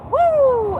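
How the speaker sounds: high-pitched held "woo"; high whoop. Real, not acted. Wide-awake vlogger glad of a good sleep, whooping. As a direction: let out a long, high whoop. Whooping about having slept well.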